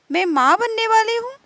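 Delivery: can't believe the news; surprised. Acted. Meena repeats the news, amazed. (हैरानी से ऊँचा उठता सवाल)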